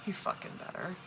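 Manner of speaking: whispered under her breath; hushed, breathy warning. Real, not acted. Muttered threat under her breath.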